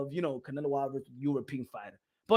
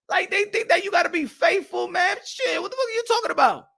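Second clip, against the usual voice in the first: ordinary delivery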